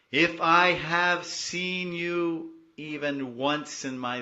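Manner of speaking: loud, high, slow devotional plea; fervent prayer. Acted. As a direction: pour out the prayer to the mother, drawn out and loud. Sings prayer of the verse fervently.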